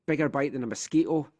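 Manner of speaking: ordinary delivery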